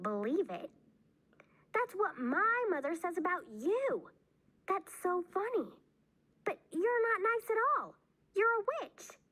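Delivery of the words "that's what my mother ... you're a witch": put-on very high, excited child's exclaiming; amazed delight. A narrator's reading. Bean can't believe both mothers said it, amazed and high. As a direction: tease excitedly, voice pitched way up.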